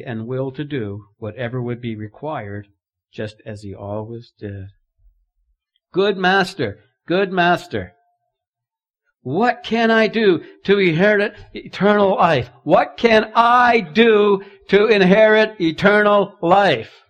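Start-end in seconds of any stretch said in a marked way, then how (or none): 5.9-17.0 "I" leaned on in "what can I do"; eager ruler pressing Jesus, hitting "I"; stress on I. Acted. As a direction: plead loudly, weight on "I". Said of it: "You see the emphasis?".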